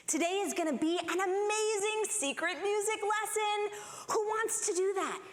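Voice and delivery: lightly voiced, high and eager preschool teacher; cheery nursery teacher pitch; sing-song teacher. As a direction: sell it like a treat to toddlers. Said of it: "she said".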